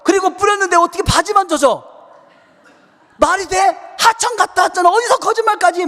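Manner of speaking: loud, high-pitched grilling; acted-out mom scolding at a high pitch; angry mother. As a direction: tear into the child's flimsy lie. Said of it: "막 화를 내시는 거예요".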